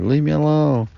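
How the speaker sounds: high mocking imitation of the girlfriend; mimicking her plea.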